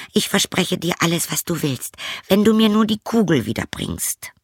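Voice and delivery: put-on high, light girlish voice, a little dismissive; a young princess shrugging at the frog. Princess brushing off the frog, high girlish voice.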